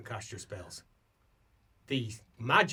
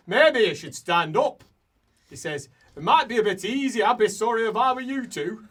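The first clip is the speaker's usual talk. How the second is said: loud, raised-pitch dwarf voice; scornful dwarf; 9 semitones higher, 11 dB louder than usual. Acted. Dwarf standing over someone curled up, loud scorn. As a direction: needle them as a gruff-mannered dwarf.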